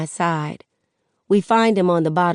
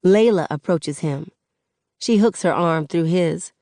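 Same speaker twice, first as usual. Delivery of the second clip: ordinary delivery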